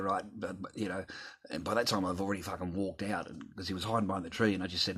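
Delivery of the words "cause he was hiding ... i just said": normal talking voice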